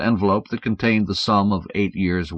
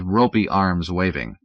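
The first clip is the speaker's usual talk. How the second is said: usual speaking voice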